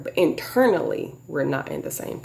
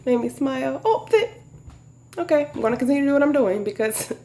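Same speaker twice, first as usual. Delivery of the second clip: imitating him, high-pitched; 10 semitones higher than usual. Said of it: "It was like".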